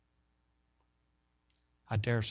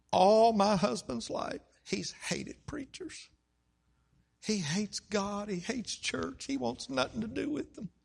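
distraught wife; 10 semitones higher, slower than usual. Retold or imitated. Wife warning pastor that husband hates preachers, distraught. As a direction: imitate a troubled wife's slow, pained warning. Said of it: "she's just distraught".

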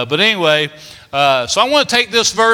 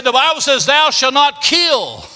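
preacher warning; 6 semitones higher than usual. Retold or imitated. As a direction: thunder it like a hellfire preacher. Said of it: "And then he said".

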